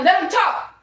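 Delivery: high, loud preacher cry; shouted. Real, not acted. Preaching defiance to haters, high loud shout. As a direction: belt it out like a sermon.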